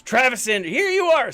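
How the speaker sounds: high, loud delighted cry; excited greeting. Real, not acted. Streamer spotting his friend Travis in chat, delighted call.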